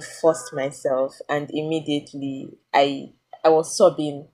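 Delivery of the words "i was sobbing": ordinary delivery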